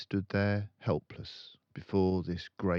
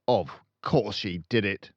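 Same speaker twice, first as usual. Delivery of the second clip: boomed; 3 semitones higher, 9 dB louder than usual. A narrator's reading. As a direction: boom it out. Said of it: "Miss Trunchbull boomed".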